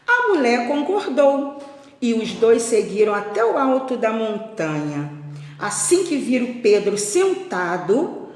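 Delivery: expressive storyteller with drawn-out key words; lilting picture-book reading; children's story narration. A narrator's reading. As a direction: read it aloud to a class of little ones. Reads story to pupils.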